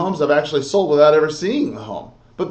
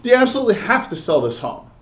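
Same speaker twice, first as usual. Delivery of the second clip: quick, higher-pitched run-through; unemphasized take; 5 semitones higher, faster than usual. Shown as a demonstration. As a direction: rush the question without leaning on "sell". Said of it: "You see how the difference there?".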